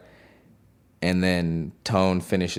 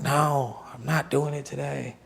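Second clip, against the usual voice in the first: imitated high-pitched whine from a distance; stressed-out protest; 8 semitones higher, 3 dB quieter than usual. Producer imitates singer overheard in the background, stressed-out protest. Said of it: "like, damn near screaming".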